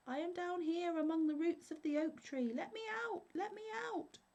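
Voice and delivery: very high, muffled little trapped-spirit voice; tiny spirit squeaking from inside a bottle; smothered cry. A narrator's reading. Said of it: "The voice answered".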